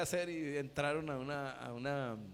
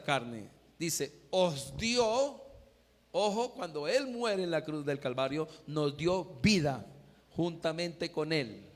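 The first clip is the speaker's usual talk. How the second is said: raised verse reading with drawn-out key words; emphatic preaching; 3 semitones higher, 5 dB louder than usual. Real, not acted. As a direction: expound the verse forcefully, pausing for weight.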